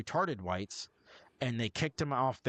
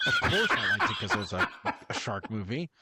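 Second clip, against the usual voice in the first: laughing; 4 dB louder than usual. Real, not acted. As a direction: admit it through a burst of laughter, fast. Laughing admission about a shark movie.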